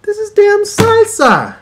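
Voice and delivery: high, loud, slow indignant squeal; outraged shriek. Retold or imitated. Tasting the wrap, he squeals in outrage. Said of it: "I'm like".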